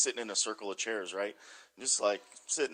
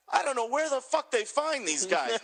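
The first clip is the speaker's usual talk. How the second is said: mocking impression; 12 semitones higher, 4 dB louder than usual. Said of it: "Perry Saturn comes walking by and he goes".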